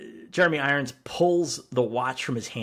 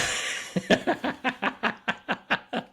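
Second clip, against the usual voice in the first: long high burst of laughter; high, helpless cackling laugh; big laugh; 10 semitones higher than usual. Real, not acted. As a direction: crack up in a drawn-out laugh. Amused by co-host's acted line.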